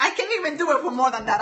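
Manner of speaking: ordinary talk, nothing marked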